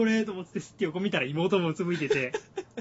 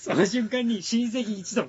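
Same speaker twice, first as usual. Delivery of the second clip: laughing; 7 semitones higher, 8 dB louder, slower than usual. Real, not acted. Recalling the punchline, cracking up.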